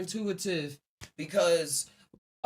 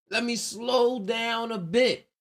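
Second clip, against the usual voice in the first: slow, higher, drawn-out words; 4 semitones higher, slower than usual. Acted. Counterintuitive racer deciding to ease off, slowing right down. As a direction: ease off and stretch each word. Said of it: "being counterintuitive will have you say".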